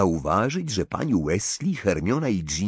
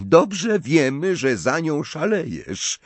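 higher, drawn-out taunt; snarling; 9 semitones higher, 4 dB louder, slower than usual. A narrator's reading. Ginny taunts her brother about his crush, snarling. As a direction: jeer slowly with irritation. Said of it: "warknęła Ginny".

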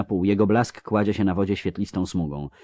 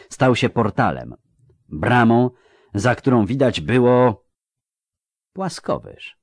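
ordinary delivery

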